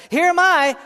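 high, loud eager volunteer; bold, ringing declaration; eager response. Acted. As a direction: call out readily, pitched high and loud.

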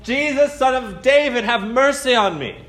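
desperate shout for mercy. Retold or imitated. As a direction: cry out harder over the crowd. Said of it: "a little louder".

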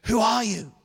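lightly voiced wary householder; nervous. Nervous woman asking the stranger on her doorstep, mildly anxious. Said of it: "who's clearly very nervous".

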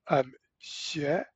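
"xue" said slowly in Mandarin. Shown as a demonstration. Host spelling out a Mandarin syllable as an example. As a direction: give "xue" its Mandarin sound. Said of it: "for example, he would put".

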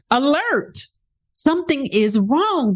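the nervous system voicing a light mock alarm. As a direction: sound like a small internal alarm going off. Body's alarm voiced.

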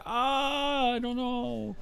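high, lost and baffled; helpless judge. Retold or imitated. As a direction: shrug it off like a stumped judge. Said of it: "they're just like".

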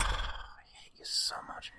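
sighing, very soft whisper; whispered mock hatred. To co-host who likes a bad film, whispered mock hatred. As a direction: whisper it slowly, like a wounded grudge. Mock grudge after co-host's remark.

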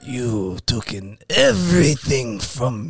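low, slow mock Korean accent; menacing hammed-up Korean accent, drawn out. Wronged brother confronts the shop owner, slow mock Korean accent. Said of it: "this is my Korean accent".

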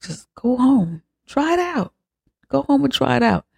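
a high, spooky mock-sweet coaxing; eerie urging. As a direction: coax her like a creepy medium. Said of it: "She's like".

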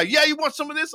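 imitated high-pitched taunt; goading challenge. Friend inviting a Boston fan to fight, imitated aggressive goading. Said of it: "he just goes off on the guy".

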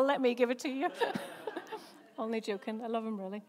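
ordinary delivery

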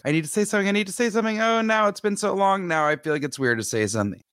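high, anxious mock inner voice; fretting to yourself about speaking up, pitched high; awkward inner panic. To her co-host, replaying her awkward dilemma about the neighbor, high and fretful. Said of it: "that awkward thing where you're like".

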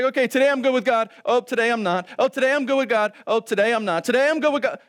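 fast, fretful back-and-forth; jittery mock worrier; mock flip-flopping believer. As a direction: play the anxious yo-yo. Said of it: "you're just like".

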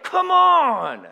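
high, loud, drawn-out urging; prodding. Acted. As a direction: poke them along impatiently. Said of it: "Poking them along".

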